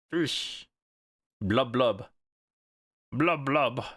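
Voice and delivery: blubbing underwater fish voice; fish voice morph. Real, not acted. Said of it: "Thank you for the fish redeem morph".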